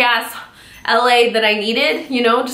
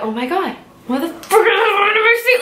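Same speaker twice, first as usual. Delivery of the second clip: much higher indignant cry; shocked outrage; 9 semitones higher than usual. Retold or imitated. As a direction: exclaim in disbelief at the stolen seat. Finds lady in her seat.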